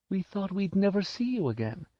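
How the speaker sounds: high, tearful relief, lightly acted; mother overcome at her son's return; sobbing mother. A narrator's reading. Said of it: "she sobbed".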